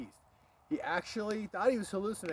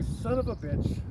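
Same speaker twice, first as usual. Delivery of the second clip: exasperated dad; 5 semitones higher, 5 dB louder, slower than usual. Retold or imitated. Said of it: "He's like".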